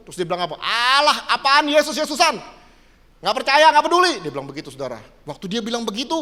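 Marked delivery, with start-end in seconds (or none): 0.6-4.1 imitating a defiant brother, higher and louder; dismissive scoff. Preacher acts out his brother rejecting the rebuke, scoffing. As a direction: wave it off in a raised, scornful voice.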